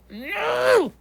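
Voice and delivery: very high, hammed-up cry of protest; exaggerated squeal of refusal; mock horrified no. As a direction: wail it way up high in pretend horror. Acts out horror at wrong answer.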